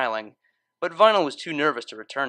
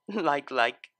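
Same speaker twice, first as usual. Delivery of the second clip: giggled. A narrator's reading. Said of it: "giggled the cellist".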